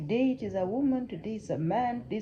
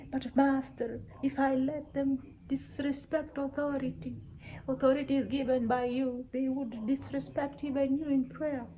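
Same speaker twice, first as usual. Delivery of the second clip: higher-pitched, insistent appeal; sustained high pleading as a cornered ruler; pleading king; 4 semitones higher than usual. Acted. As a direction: argue humbly with your master. King pleads with his master.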